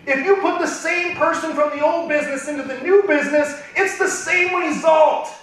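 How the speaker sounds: loud, high, full-force rally speech; impassioned preaching. Real, not acted. Speaker rallies the team to make it happen, preaching at full force. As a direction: drive every phrase home like a fired-up preacher.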